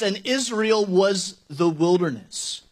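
normal talking voice

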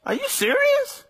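mock-exasperated God looking down; incredulous God. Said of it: "I'd be looking down at y'all like".

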